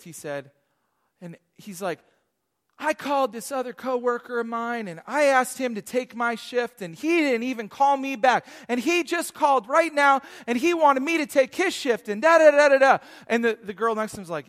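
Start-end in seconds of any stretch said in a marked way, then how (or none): 2.8-13.0 mock venting, louder and higher, running on; imitated frustrated rant. Barista griping about a coworker's shift, mock frustrated rant. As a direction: act out a worked-up gripe. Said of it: "All this just frustration".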